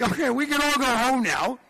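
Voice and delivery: high, loud mock-cheery dismissal; sarcastic quip. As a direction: toss it off like the party's over. Wry retort after the reverend.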